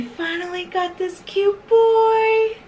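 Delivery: high, slow doting coo; doting owner cooing. Real, not acted. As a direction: gush over the puppy. Gushing over the puppy.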